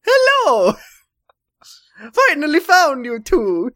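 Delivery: high loud menacing cartoon voice; villain Mario voice. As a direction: greet them like a creepy villain. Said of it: "he said".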